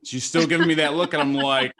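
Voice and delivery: ordinary talk, nothing marked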